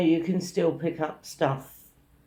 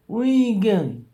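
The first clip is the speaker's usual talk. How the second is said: raised, loud heckle after someone; mimicked yelling; 5 semitones higher, 6 dB louder than usual. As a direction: shout it like a nosy pest. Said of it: "that's how much you were yelling".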